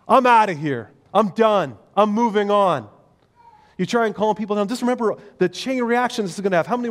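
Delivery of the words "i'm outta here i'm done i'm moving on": louder, fed-up storming-off voice; mock huff. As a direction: slam the door with your words.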